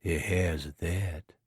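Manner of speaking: soft, slow, few words; a silent man's soft, slow reply; quiet voice. Acted. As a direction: answer quietly, unhurried. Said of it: "replied in his quiet voice".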